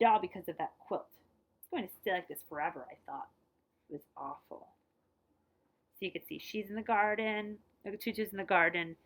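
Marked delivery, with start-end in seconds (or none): none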